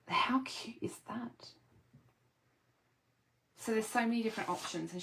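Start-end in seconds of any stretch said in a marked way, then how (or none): 0.1-1.5 whispering. Real, not acted. Excited whisper over craft die.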